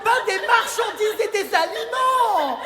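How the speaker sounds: high, slow, hammed-up indignation with a laugh; mock outrage. Host scolding the girls for calling men cakes, mock indignant. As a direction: play the offended activist for laughs.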